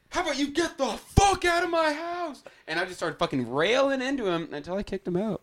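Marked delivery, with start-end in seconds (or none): 0.1-2.4 high, mock-furious throwing someone out; a replayed outburst, pitched high; imitating his own rage at a friend; retold angry yell. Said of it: "and I was like".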